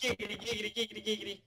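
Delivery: high cartoon giggity catchphrase; Quagmire impression.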